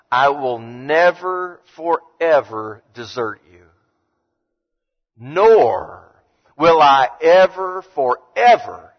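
"never forever" drawn out slow and loud; slow stressed promise. Shown as a demonstration. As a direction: stretch out "I will never forever desert you" with weight on each word. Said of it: "when you start to look".

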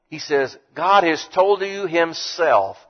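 usual speaking voice

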